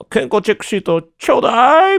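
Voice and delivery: very high, sing-out request; cheerful auntie. Retold or imitated. Auntie asking arrivals for health forms, cheery high call. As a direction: imitate a breezy airport lady, stretching the end. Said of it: "陽気なおばさんがですね".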